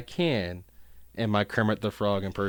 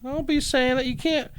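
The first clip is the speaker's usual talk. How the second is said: exasperated high protest. Real, not acted. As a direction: playfully scold him for undercutting it. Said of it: "Would you stop that?".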